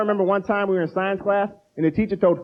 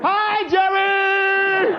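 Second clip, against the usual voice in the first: high, drawn-out group shout; group greeting; 11 semitones higher, much slower than usual. Retold or imitated. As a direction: call it out like a whole room. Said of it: "AA meeting group reply".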